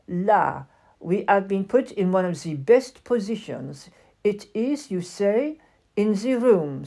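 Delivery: put-on lightly French-accented proud doll; airy French lady, a touch grand; a hint of a haughty French doll. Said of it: "Why does she talk like that?".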